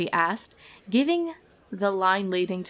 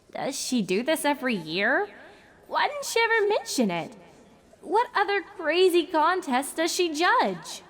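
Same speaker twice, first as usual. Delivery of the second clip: rising bewildered thoughts; 9 semitones higher than usual. A narrator's reading. Twilight's racing thoughts.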